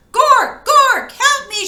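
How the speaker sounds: high, loud cry for help. Acted. Bork chased by the robot, crying to her brother for help. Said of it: "she cried".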